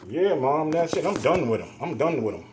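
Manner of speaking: loud, emphatic and finished; exasperated, forceful retelling; retold fed-up declaration. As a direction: raise your voice, cutting him off for good.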